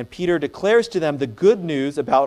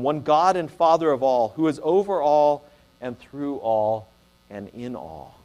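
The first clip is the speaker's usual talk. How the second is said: scripture reading; 3 dB louder than usual. Acted. Preacher proclaims Paul's creed to the church, rising then fading. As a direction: proclaim the creed, then let it fall away. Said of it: "Hear Paul's words again".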